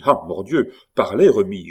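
loud oath, then a pressing order; forceful, impatient urging; urgent demand. Acted. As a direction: swear and demand that he speak. Saint-Luc urgently demands the news.

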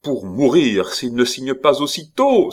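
high, loud, biting command; shrill imperious threat; sour, imperious. A narrator's reading. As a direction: snap it out as a haughty duchess. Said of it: "la voix aigre et impérieuse".

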